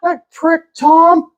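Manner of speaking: high, griping Pittsburgh voice; Pittsburgh accent. Retold or imitated. Neighbor cursing Tom, high Pittsburgh accent. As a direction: gripe high in broad Pittsburghese. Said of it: "that's a Pittsburgh accent".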